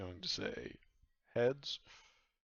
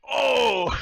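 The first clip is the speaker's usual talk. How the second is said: high, loud disgusted Oh; pirates' loud shout; 16 dB louder than usual. Acted. Pirates at the table shout in disgust, loud high cry. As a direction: bellow it like a crowd of pirates. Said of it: "all the pirates around go like".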